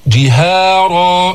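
slow جهارا, thin هـ then thick ر; drawn-out جهارا with a full, heavy ر; light ha, heavy ra. Shown as a demonstration. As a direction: keep هـ light in جهارا, then darken the final "raa". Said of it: "انتقلنا إلى تفخيم الراء المفتوحة".

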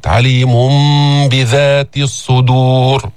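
drawn-out "alimum-bidhat" recitation; hidden meem. Shown as a demonstration. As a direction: hum the n of عليم as a nasal m into بذات. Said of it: "قلبت ميم مخفاة".

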